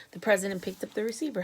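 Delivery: plain reading voice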